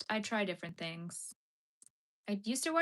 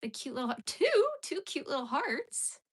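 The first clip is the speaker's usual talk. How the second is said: cutesy coo; 6 semitones higher, 3 dB louder than usual. Acted. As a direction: gush over them like a baby voice. Cooing over nail art.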